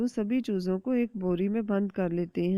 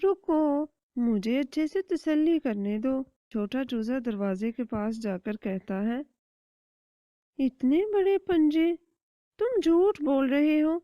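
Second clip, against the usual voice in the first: much higher, eager little chick; small child chick voice; 6 semitones higher than usual. Acted. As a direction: ask mother with childish curiosity.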